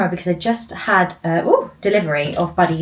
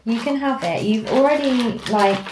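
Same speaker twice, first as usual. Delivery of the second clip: light, higher voice for the pet; sweetly telling the dog she can have the treats; talking to the dog; 6 semitones higher than usual. Real, not acted.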